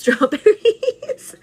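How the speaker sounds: laughing it out high and slow. Real, not acted. To her viewers, naming breakfast with a laugh. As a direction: giggle through the word. Laughing excited food reveal.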